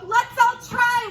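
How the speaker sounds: high, loud rallying call; rally everyone with a shout; loud eager cry. Acted. Crowd cry.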